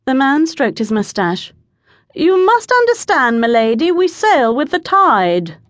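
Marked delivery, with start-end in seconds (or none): 2.2-5.6 gentleman calling down. A narrator's reading. Man above explains to the lady.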